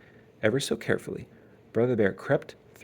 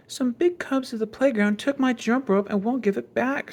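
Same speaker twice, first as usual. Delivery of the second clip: put-on high young cub's voice, aggrieved; child complaining; 12 semitones higher than usual. Little sister telling mother about the stolen rope, high and aggrieved. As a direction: whine out the grievance like a little girl.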